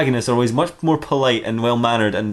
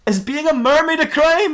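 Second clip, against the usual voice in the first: slow, high comic indignation; incredulous challenge. Acted. Detective challenging officers over the charge, incredulous. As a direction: draw out the mock-outraged question, high.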